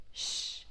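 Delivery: "Sh" as a voiceless hush; just the consonant "Sh", no vowel; soft airy "Sh" without voice; sh sound. Shown as a demonstration. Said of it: "So it's sh sound".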